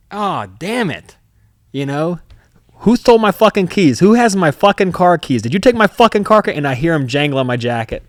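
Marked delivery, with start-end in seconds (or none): 0.1-1.2 slow, stretched-out damn it; rueful, slowed oh damn it; drawn-out groan. Retold or imitated. Retold realization, inner voice. 2.8-6.5 loud, higher, furious demands; mock rage hunting for car keys; angry yelling. Retold rant over lost keys.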